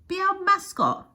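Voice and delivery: high, loud, drawn-out call; crowd's cry. A narrator's reading. Marchers begging Nessie to join, crying out. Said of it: "they cry".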